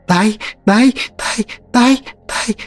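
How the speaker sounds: high, shrill, insistent spirit chant; shrill demanding spirits. Acted. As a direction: shriek demands like hungry spirits. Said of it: "требовательно верещал". (требовательный визг духов)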